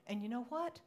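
usual speaking voice

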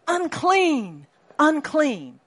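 loud, high cry of unclean; a leper's loud, high warning cry; shouted warning. Retold or imitated. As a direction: call it out loud to warn people off. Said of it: "they were supposed to shout loudly".

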